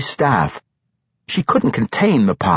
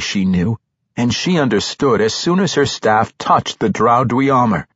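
ordinary delivery, nothing marked

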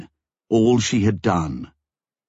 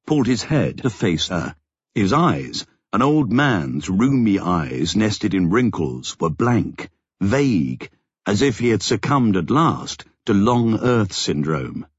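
ordinary delivery, nothing marked

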